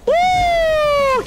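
loud, high whoop; high triumphant woo; hyped cheer. Real, not acted. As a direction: celebrate the kill with a whoop. Hyped after a kill.

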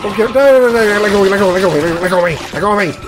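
high frantic pleading; real panic while being grabbed; being chased. Real, not acted. Grabbed by monster.

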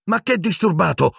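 high-pitched, heated retort; furious. A narrator's reading. Harry answering back to the nurse, furious. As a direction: fire back in exasperation. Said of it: "ribatté Harry furibondo".

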